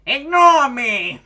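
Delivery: loud flustered slow yell; embarrassed yell. Acted. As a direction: shout it to cover embarrassment. Character embarrassed outburst.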